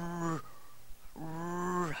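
quiet hint, "R-" drawn; soft sounding out the r. Retold or imitated. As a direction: prompt the child by sounding out. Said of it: "it's a short A".

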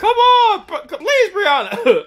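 high loud laughing plea; pleading yell. Retold or imitated. Uncle stuck without toilet paper, loud high pleading. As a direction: beg at the top of your voice.

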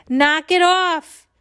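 high, loud, drawn-out order; sudden shout. A narrator's reading. Security guard silences the joking announcer, sudden shout. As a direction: cut off the jokes with a shout. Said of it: "Officer Spence suddenly shouted".

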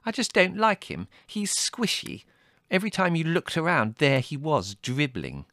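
high-pitched, indignant sister. A narrator's reading. Margot complaining about the squishy guest, indignant. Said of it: "said Margot indignantly".

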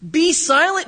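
high, loud "Be silent"; loud command. Acted. Jesus commanding the demon to be silent, loud. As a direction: bark "Be silent" like an order. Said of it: "Jesus said".

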